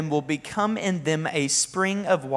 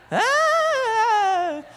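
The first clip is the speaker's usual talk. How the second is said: long, high held "ah"; one steady high note of exasperation; high drawn-out cry. Acted. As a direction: let out a sustained high wail. Mock exasperated cry amid wishes.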